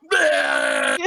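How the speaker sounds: high, drawn-out retching bleat; horrible puking noise. Retold or imitated. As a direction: mimic tearful, helpless vomiting. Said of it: "they had a horrible noise like that".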